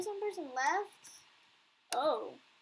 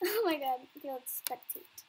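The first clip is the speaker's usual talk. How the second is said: ordinary talk, nothing marked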